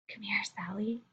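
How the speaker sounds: breathy whispered summons. Shown as a demonstration. Teacher demos whispering to Sally. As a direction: whisper it as a secret. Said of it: "I said the same thing in a ton of different ways".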